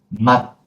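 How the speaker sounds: 맛 stopped short on a silent t; closed, cut final. Shown as a demonstration. Teacher repeats after explaining, 맛 closed and cut. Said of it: "we have to close and cut".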